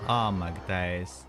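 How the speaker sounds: ordinary talk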